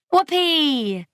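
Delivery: high, drawn-out whoop; gleeful party whoop; joyful cheer. A narrator's reading. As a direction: cheer with delight, pitch soaring. Delighted cheer as flames blow out.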